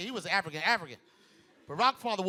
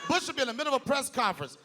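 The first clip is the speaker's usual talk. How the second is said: ordinary talk, nothing marked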